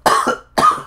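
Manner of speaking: harsh breathy cough. Real, not acted. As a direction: cough it out, off-mic. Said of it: "Sorry, I'm, like, crying".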